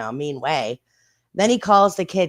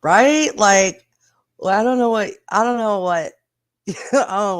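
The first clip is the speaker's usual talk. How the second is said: suddenly high and loud, laughing disbelief; high, loud burst of laughing disbelief; excited outburst; 6 semitones higher, 6 dB louder than usual. Real, not acted. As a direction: blurt it out, amused and incredulous. Laughing reaction to a comment.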